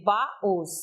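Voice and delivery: "baús" slowly split into ba-ÚS; syllables separated. Shown as a demonstration. Teacher giving an example of the rule, syllables separated. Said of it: "Esse us foi acentuado".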